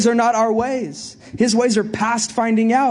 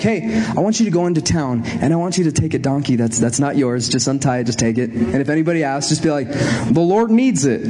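Jesus giving instructions; 7 semitones lower than usual. Retold or imitated. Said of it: "he's like".

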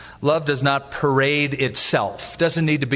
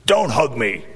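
short retold growl, falling at the end; mock growl; 3 semitones lower than usual. Pastor repeating the enemy's rebuff from a book, mildly growled. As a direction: lightly growl the quoted rebuff. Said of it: "he growled".

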